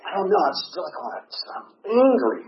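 high-pitched, flustered mock anger; retold anger. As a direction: act out stammering, exasperated irritation. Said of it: "I'm sitting there so angry".

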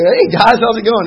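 put-on high, loud cheerful hello to old bar friends; bright, booming hey guys greeting; hearty greeting. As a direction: call out a big friendly hello.

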